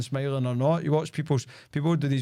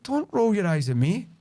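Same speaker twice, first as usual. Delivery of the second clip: firm rebuke; 7 semitones higher, 3 dB louder than usual. Retold or imitated. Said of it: "I'm like".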